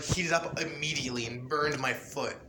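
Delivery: usual speaking voice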